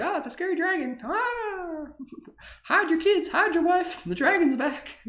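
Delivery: very high, squeaky mock panic; hammed-up high-pitched villager panic; panicked villagers. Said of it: "They're like".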